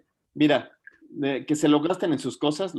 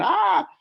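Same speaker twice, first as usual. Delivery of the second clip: a short, very high, loud mock scream; imitated child scream; 7 dB louder than usual. Doctor imitates a child screaming during the X-ray. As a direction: yelp it out like a frightened kid. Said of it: "Y así gritan".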